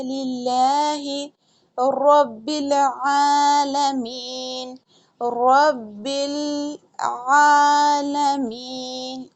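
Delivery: लिल्लाहि with a thin lam, drawn out slowly; thin lam recitation. Shown as a demonstration. Teacher showing students the thin lam, slow chanted recitation. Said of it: "लामे जलाला जो है वो बारीक करके पढ़ेंगे".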